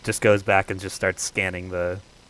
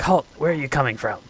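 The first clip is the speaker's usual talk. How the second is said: kobold guard; 6 semitones higher, 4 dB louder, faster than usual. Acted. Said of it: "he's like".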